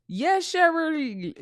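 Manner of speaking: put-on slow Jamaican accent, drawn out. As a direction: stretch it slowly in a Jamaican accent. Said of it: "my Jamaican accent".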